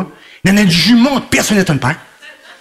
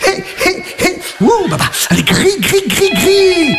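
high excited urging; 9 semitones higher than usual. Pretend. Urging the grill, high yelps.

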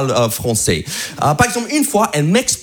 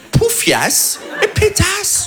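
drawn-out mock insults; 11 semitones higher, much slower than usual. Listing French insults.

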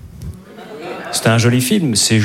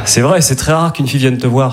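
usual speaking voice

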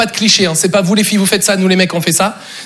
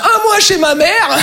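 high, drawn-out lament; exclaimed punchline; 9 semitones higher, slower than usual. Acted. Comedian punished with a stay at mother's, high mock dismay. Punchline after failed answer.

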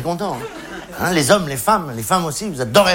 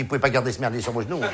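quick, softer mock flight attendant; flight attendant quote; 5 semitones lower, 6 dB quieter, faster than usual. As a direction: rattle it off like a bored hostess, quieter. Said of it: "elle te dit".

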